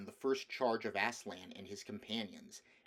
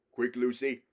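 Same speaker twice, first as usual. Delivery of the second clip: commanding lion; 2 semitones higher, 10 dB louder than usual. Acted. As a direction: call her over with urgency. Aslan urges Lucy to hurry.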